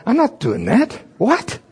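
high-pitched indignant refusal; mock aghast inner voice; inner protest. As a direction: recoil at the command. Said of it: "On the inside of".

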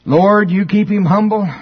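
high, loud congregation praying in jest; joking mock prayer. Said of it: "they did it like a joke".

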